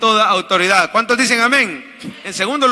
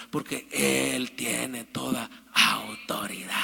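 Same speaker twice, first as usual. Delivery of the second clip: whisper; 8 semitones lower, 10 dB quieter, slower than usual. Acted. Dramatic close of the point.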